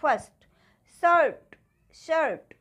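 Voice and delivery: फर्स्ट, शर्ट with an "uh" vowel in place of i. Shown as a demonstration. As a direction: project फर्स्ट, शर्ट as separate model words with "uh". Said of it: "आ की तरह बोल रहे हैं".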